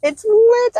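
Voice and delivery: very high, drawn-out mimicry; echoed catchphrase impression. Co-host echoing an Instagram catchphrase, high mimicry.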